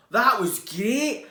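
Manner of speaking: loud, high, slow delight; loud joyful wonder; thrilled. Acted. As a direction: gush with joy. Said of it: "said Norman".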